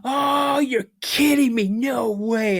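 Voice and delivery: high, laughing no-way; imitating a laughing teen; mock disbelief, laughing. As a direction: scoff in high-pitched disbelief. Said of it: "And I started laughing".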